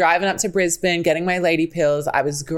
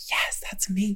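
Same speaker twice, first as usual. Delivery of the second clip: whispered inner voice; 3 semitones higher, 8 dB quieter than usual. Retold or imitated. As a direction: breathe it as a secret thought. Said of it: "in my head".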